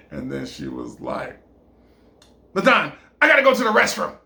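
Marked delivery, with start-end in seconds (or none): 2.5-4.1 high, loud and rushed; urgent announcement. Acted. Woman suddenly excuses herself to restroom, urgent and rushed. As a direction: blurt it out fast and loud.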